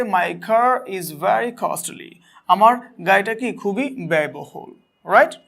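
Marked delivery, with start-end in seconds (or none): 0.0-2.1 slow example read. Shown as a demonstration. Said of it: "এক্সাম্পলে বলা হয়েছে".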